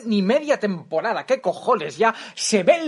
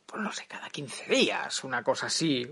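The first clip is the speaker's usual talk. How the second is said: whispering an offhand aside; whispered aside; 4 semitones lower, 9 dB quieter than usual. Real, not acted. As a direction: whisper it as a throwaway. Aside under breath.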